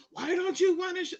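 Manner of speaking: high, loud demanding question; guard yelling at the husband over the receipt; angry guard yell. Retold or imitated. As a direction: throw it at him like an accusation.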